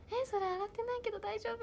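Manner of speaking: high-pitched mimicry of a friend. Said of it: "で、ユウが". (甲高い声で友達の真似)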